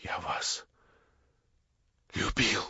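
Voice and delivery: почти шёпотом, надломленно; выдохни признание; тихое признание. Acted. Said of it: "вырвалось у него наконец". (сокровенное признание шёпотом)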